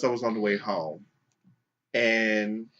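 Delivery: usual speaking voice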